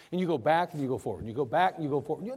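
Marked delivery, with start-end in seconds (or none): none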